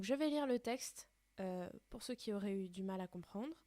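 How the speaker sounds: ordinary delivery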